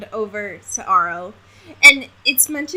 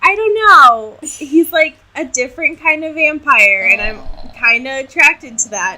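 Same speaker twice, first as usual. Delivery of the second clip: a little higher and louder, swooning; dreamy Bella impression; 5 semitones higher, 7 dB louder than usual. S0 mocking Bella's crush on Aro, swooning. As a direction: gush about the vampire like a smitten teen.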